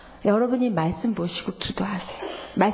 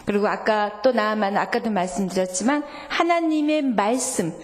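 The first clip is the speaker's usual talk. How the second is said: ordinary talk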